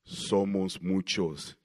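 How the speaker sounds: voz grave, fuerte y lenta de demonio. Acted. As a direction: suelta las palabras lentas y muy graves, como un demonio. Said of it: "puede salir una voz". (voz demoníaca, grave y potente)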